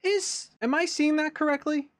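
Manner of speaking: sudden high, startled double-take question; blurting out disbelief at what's on screen; surprised. Real, not acted. Reacting to a trailer, startled and asking aloud.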